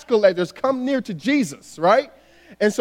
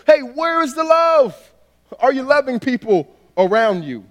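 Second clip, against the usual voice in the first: acted challenge in a raised pitch; challenging appeal; 4 semitones higher than usual. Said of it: "lifting his hands up to the religious people".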